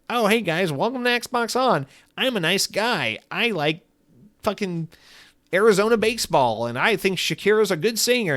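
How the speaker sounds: high, cheery host voice; chirpy nice-guy podcast welcome. Pretend. Rival host welcoming [name], cheery mock intro. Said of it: "[name]'s like".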